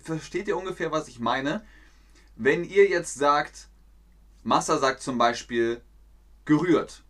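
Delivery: normal talking voice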